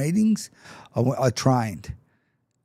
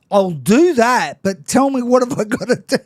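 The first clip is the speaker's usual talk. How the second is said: eager pleading; 8 semitones higher, 5 dB louder than usual. Retold or imitated. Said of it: "I said".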